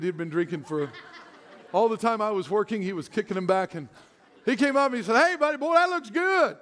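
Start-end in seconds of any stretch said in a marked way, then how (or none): 5.2-6.6 loose, slightly higher Southern Alabama greeting; Alabama drunk. Retold or imitated. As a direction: lightly play the drunk guy from Alabama. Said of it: "He's from Alabama".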